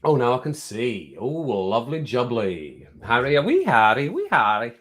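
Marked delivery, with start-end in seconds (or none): none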